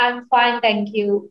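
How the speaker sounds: flat, mechanical I'm fine, thank you; mildly bored stock answer; stiff rote reply. Retold or imitated. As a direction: recite it like a robot. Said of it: "jawabannya adalah".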